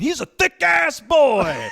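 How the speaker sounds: put-on growly Southern voice, pitched high and laughing; mock Southern good old boy, said through a laugh; growly Southern accent. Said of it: "cutting into me going".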